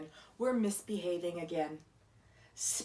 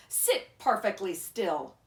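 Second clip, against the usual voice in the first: louder, firm command; stern Miss Swamp; 8 dB louder than usual. A narrator's reading. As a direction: demand silence. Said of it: "said Miss Swamp".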